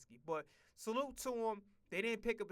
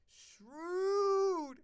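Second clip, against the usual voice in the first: high, loud, sustained shrewd; drawn-out exclamation; 11 semitones higher, 7 dB louder than usual. Real, not acted. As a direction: stretch shrewd out. One-word drawn exclamation.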